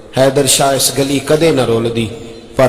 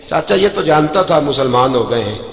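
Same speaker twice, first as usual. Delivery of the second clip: ordinary talk, nothing marked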